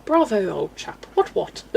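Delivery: hammed-up posh accent; plummy, exaggerated posh English; over-the-top posh. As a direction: put on an absurdly posh accent. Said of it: "Very over the top posh".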